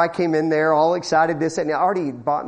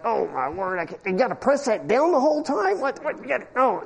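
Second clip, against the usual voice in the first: higher, quieter, fumbling panic; a rookie overwhelmed by the strings; flustered beginner; 4 semitones higher, 3 dB quieter than usual. Retold or imitated.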